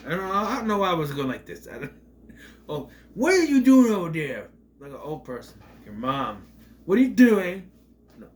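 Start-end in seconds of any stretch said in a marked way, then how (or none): 2.7-4.5 loud, high startled call across the room; sudden yell. Real, not acted. Reviewer shouting at someone in the room, loud and high. 6.9-7.7 loud, high startled call across the room; sudden yell. Real, not acted. Reviewer shouting at someone in the room, loud and high.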